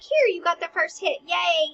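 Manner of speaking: high, loud fake-cheery congratulation; mock cheering. King congratulating Hamlet on the first hit, mock cheering. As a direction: cheer him on with a big hammed-up yay.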